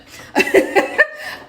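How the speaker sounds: high, loud breathless laugh at the edge; hysterical laugh. Retold or imitated. Mother near breaking point in airport, hysterical high laugh. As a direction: laugh like you're about to lose it. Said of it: "I'm almost hysterical".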